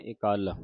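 ਇਕੱਲ with the first i set apart; ਇਕੱਲ, a distinct i before kall; separated first vowel. Shown as a demonstration. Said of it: "ਵੱਖਰੀ ਸੰਬੰਧਕ ਲਾ ਕੇ ਉਚਾਰਨ ਕਰਨੀ".

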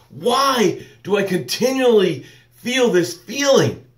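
high, anguished pleading, nearly crying; mock despair of someone drowning in doubt; distressed cry. Drowning listener cries out in high anguish. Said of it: "we're like".

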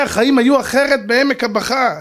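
normal talking voice

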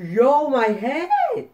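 high, loud, drawn-out outburst; dismayed yell. Real, not acted. Gamer reacting to being hit in the head, loud high yell. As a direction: cry out in outrage, pitch shooting up.

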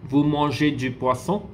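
"Vous mangez du poisson" rising at the end into a question; statement word order, asked with a rising tune; questioning intonation. Shown as a demonstration. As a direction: lift "poisson" as if asking. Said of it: "It is actually in intonation form".

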